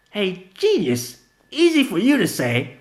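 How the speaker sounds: slow, high, loud mocking jab; sarcastic retort. Vanilla mocking Gina in the fight, sarcastic. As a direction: drag out the sarcasm.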